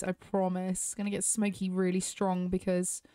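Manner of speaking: ordinary talk, nothing marked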